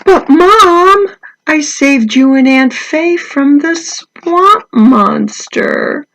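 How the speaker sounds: put-on high, loud pleading child voice, slowing down; a kid insisting he was the hero; boy protesting to Mom, high and loud; Mikey's protest.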